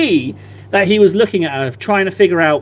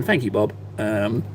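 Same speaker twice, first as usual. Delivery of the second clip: ordinary talk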